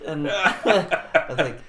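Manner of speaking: ordinary talk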